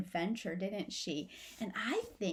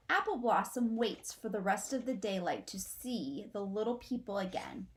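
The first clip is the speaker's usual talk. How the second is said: usual speaking voice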